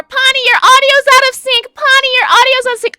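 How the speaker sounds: high, loud mimicry of complaints; mock nagging viewer. Streamer mimics chat complaining about audio, high mock nagging.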